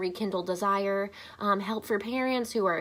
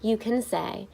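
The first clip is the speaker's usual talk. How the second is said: ordinary delivery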